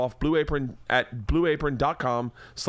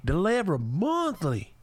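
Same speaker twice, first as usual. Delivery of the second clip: hammed-up Southern accent; 12 semitones higher than usual. Host mocks wife reading meal plans in a Southern accent. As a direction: stretch each word in a Southern drawl. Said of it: "I wish I hadn't done it in that accent".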